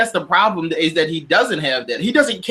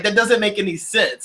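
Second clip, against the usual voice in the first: normal talking voice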